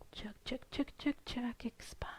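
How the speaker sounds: soft rhythmic whisper; whispered count. Shown as a demonstration. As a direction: tick off each pulse under your breath.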